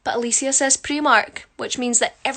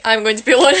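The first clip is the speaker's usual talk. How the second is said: laughing; 9 dB louder, much faster than usual. Real, not acted. Laughing at own joke.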